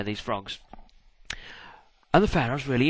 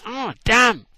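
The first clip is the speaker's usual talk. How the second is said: comic dismay; 10 semitones higher, 6 dB louder than usual. Acted. Moses' deflated curse.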